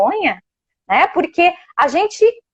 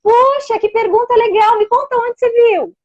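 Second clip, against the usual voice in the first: much higher, bright encouraging praise; welcoming and curious; 8 semitones higher than usual. Shown as a demonstration. Parent praising child's question, warm welcoming invitation. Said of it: "a tua entonação, a maneira como você tá acolhendo".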